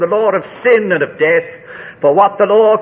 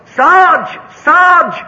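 high, loud call across no man's land; shouted call; 9 semitones higher, 6 dB louder than usual. Retold or imitated. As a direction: shout for the sergeant from far off. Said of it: "shouting to him right out".

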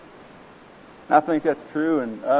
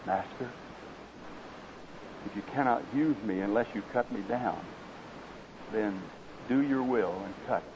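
much softer, hushed and low; whispered surrender; 3 semitones lower, 10 dB quieter than usual. Acted. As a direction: give in on a hushed breath. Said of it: "There came a whisper".

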